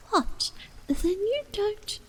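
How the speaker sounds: high, tearful insect voice; tiny voice. A narrator's reading. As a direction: squeak it out, close to tears. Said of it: "the little voice began".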